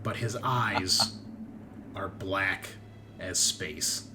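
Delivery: suspenseful game master narration, long pauses; scene-setting narration. Acted. Game master showing players one last thing, suspenseful narration. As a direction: draw out the final reveal in pieces.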